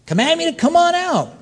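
high-pitched, eager request; eager Peter. Acted. Peter asks the Lord to call him out, eager. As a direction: pitch it up, rash and keen.

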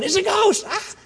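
high-pitched frightened cry; mock-panicked yelp with a gasp; mock terror. Disciples cry out at a ghost on the water, mock terror.

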